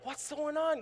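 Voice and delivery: high-pitched baffled question; acted bewilderment. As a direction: ask it in mock alarm, pitch jumping. Acts bewilderment greeting his friends.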